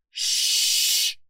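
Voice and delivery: long librarian's "shh"; sharp, drawn-out hush; stern shushing. A narrator's reading. Librarian glaring at the noisy detective, shushing sternly. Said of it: "Mildred instructed".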